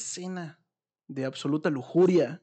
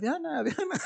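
higher, hammed-up call with a rising end; fake calling; 13 semitones higher than usual. Girl pretending to look for her sister on the beach, fake call. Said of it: "a gritar".

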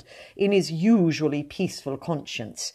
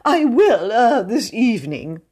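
pompous poet, pitched well up; a fussy, self-important high voice, full of ums; 6 semitones higher, 5 dB louder than usual. A narrator's reading. Mr Jones reads his spring poem to admirers, high-pitched and pompous.

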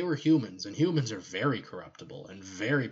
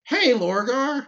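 much higher, slow, syrupy greeting; mock sweet comforter; 13 semitones higher, 7 dB louder, slower than usual. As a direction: coo it like a fake-kind friend. Erebus voiced consoling Lorgar.

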